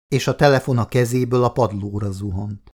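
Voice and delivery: ordinary delivery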